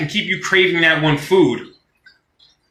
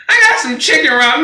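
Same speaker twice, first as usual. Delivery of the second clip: higher-pitched mimicry of a proud buyer; lightly put-on boast, pitched up; mock ramen buyer; 6 semitones higher, 3 dB louder, faster than usual. Someone boasting about their chicken ramen, mocking imitation.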